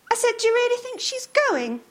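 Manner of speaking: put-on high child's voice; little girl. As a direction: ask like a small girl. Sally repeats louder.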